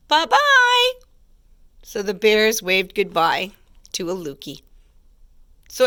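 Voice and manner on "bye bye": very high, loud cub call; baby bear squeaking a farewell; high squeaky little voice. A narrator's reading. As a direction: squeak it after her as a tiny bear. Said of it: "high, squeaky little voice".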